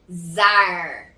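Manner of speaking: "zar" with a long growled R; drawn-out final R. Shown as a demonstration. Teacher demonstrating the syllable's sound, drawn-out R. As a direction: hold the R at the end of "zar". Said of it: "Então fica com o som de".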